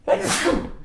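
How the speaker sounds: sudden sharp sneeze. Real, not acted. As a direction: let out a real sneeze. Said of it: "sorry about the sneeze".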